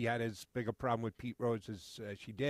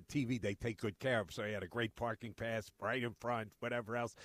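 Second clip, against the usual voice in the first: ordinary talk, nothing marked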